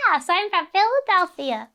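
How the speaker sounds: high-pitched imitation of own child self; eager kid caller. Eleven-year-old answering the agent, high kid voice. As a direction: pipe up like a kid on the phone. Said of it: "I was like".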